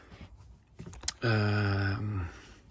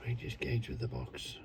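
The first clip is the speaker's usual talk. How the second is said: awed whisper; 9 dB quieter than usual. Real, not acted. Gasp, then repeats in awe.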